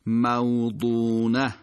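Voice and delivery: موضونة slowly, heavy Arabic ḍād and long ū; careful Quranic pronunciation. Shown as a demonstration. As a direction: draw out "maw-ḍūū-nah" in موضونة. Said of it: "چرا دقت خواهند کرد".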